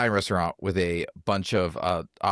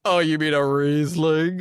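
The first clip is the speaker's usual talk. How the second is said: high, louder smug correction; condescending; 7 semitones higher, 4 dB louder than usual. Retold or imitated. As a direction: talk down to the boss. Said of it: "I'm not gonna, like, lean in".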